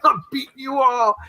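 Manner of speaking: high, far-off mocking voice of the guy who sprinted; hammed-up high taunt; taunting sprinter. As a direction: squeak it out like a gloating rival. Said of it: "He was like".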